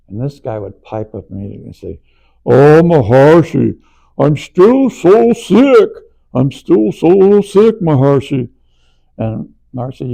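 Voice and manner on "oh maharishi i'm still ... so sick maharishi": slow, high, loud moaning whine; whiny complaint. Retold or imitated. Swedish course member moaning to Maharishi, slow high loud whine. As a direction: drag out every word like a sick martyr.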